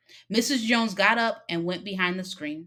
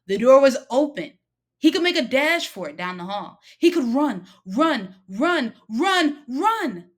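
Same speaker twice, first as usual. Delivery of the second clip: rising urgency; 6 semitones higher, 7 dB louder than usual. A narrator's reading. Boy tempted to flee.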